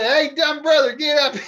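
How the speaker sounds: stage shout to a dumb brother; mock call out. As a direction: holler for the brother, half in play. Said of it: "I said".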